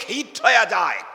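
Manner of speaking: ordinary delivery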